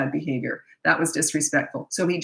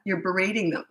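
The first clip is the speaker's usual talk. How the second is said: usual speaking voice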